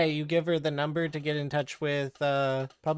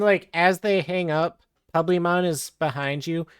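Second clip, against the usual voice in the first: usual speaking voice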